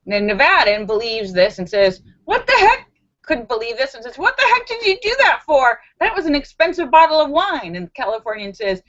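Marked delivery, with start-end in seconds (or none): 2.2-2.9 high, loud mock disbelief; shocked disbelief. Nevadan sees the wine bottle shot, shocked disbelief. 4.2-7.8 high-pitched mock outrage, easing slightly; incredulous protest. Nevadan to Californian who shot the wine, incredulous protest.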